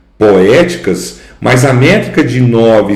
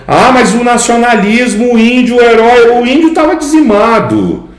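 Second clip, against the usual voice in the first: high, ironic mock enthusiasm; mocking idealization; 12 semitones higher than usual. Teacher mocking the romantics' heroic Indian, ironic. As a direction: wave away their ideal with irony. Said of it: "mocks romantic idealization".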